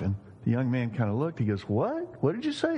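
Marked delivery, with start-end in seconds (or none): 1.7-2.8 high-pitched acted disbelief; baffled surprise. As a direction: play a mourner caught off guard, pitch jumping up. Puzzled mourner in acted story.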